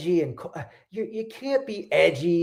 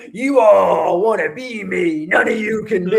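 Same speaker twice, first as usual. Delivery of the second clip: mock boastful shout; 4 semitones higher, 5 dB louder than usual. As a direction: boom it out like a cocky show-off. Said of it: "he was like".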